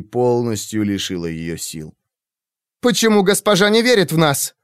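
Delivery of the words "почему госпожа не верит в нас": high, loud indignant cry; flaring up. A narrator's reading. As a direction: flare up in anger. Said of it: "вспыхнула Шейден". (вспылить громко)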